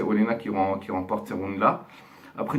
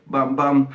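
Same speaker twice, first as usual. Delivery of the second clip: punchy bam bam, louder; mock punch sounds; 4 semitones higher, 7 dB louder than usual. A boxing fan telling viewers how Estelle hit, loud mock punch sounds. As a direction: slam out each bam like a blow landing. Miming punches landing in the fight.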